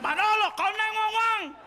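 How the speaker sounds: high, urgent call to the cook; alarmed waiter. Retold or imitated. Waiter calling to the cook, high and urgent. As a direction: yell it back into the kitchen. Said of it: "He's like".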